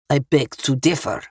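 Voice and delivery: put-on Russian-accented man, measured and menacing; thick Russian accent. A narrator's reading. Armed Russian answers Eva's warning, thick Russian accent. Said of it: "a man with a thick Russian accent".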